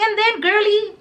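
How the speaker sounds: high, cutesy mock concern; playful teasing. Storyteller to sighing friend, playful high teasing.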